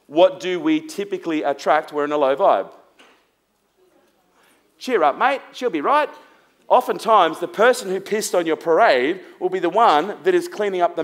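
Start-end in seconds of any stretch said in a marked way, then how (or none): 4.8-6.1 higher, chirpy Aussie cheer-up; light, high send-up of a cheery friend; breezy consoling mate. Acted. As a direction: brush off the sulk with a bright pat on the back.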